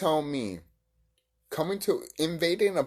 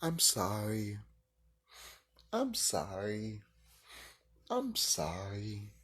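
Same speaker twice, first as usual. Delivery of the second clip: soft, slow, lower mock apology; lightly imitating a sheepish cartoon man; South Park apology; 6 semitones lower, 9 dB quieter, slower than usual. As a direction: drag out each sorry, meek and quiet. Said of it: "and he's like".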